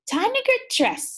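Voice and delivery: 1 mom voice. Acted. Mom's line read aloud.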